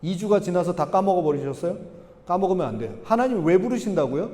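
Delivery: ordinary talk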